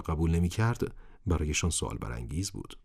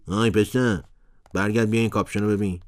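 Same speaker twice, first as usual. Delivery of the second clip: put-on higher, louder call after the boy; 4 semitones higher, 6 dB louder than usual. A narrator's reading. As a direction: call him back across the shop. Shopkeeper calls boy back.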